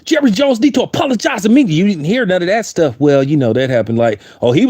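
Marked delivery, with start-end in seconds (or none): 0.0-1.6 high-pitched ranting imitation of a screamer; mock screaming tirade. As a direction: rant in a raised, indignant mock voice. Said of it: "what happened to all that screaming".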